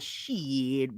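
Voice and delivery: low, drawn-out casual mumble of an employee; casual young cashier. Retold or imitated. Young cashier listing sides to a customer, low casual drawl.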